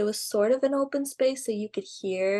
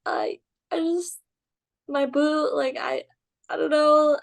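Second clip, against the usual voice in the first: retold panic, halting and higher-pitched; flustered stammer; 6 semitones higher than usual. Trainee caught by the boss, acted flustered stammering. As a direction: act out fumbling for an excuse. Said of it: "I couldn't even get a word out".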